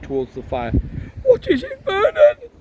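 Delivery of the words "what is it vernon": very high, louder panicked cry to husband; terrified aunt. Acted. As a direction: shriek it in panic at the fire.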